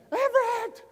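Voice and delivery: a sudden piercing scream of a name, pitched far up; retold frantic scream. Wife screaming at son climbing the bridge side, piercing yell. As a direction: shriek the child's name in panic. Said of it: "her screaming his name".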